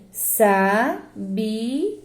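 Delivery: "sabia" as SA... BI... A with gaps; separated syllables. Shown as a demonstration. Teacher dividing the word for pupils, syllable by syllable. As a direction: pause after each syllable of "sabia". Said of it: "eu separo assim".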